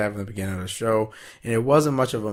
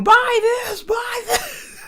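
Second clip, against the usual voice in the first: hawking shout; much slower than usual. Retold or imitated. Reviewer voicing Ziggler shouting to sell shirts, very high. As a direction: sell the shirt like a carnival hawker.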